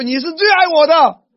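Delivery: wife's loud delighted claim to her husband; excited declaration. Shown as a demonstration. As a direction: beam it at your husband. Wife to husband, playful.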